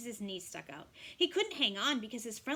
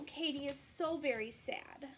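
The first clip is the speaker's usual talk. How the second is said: ordinary delivery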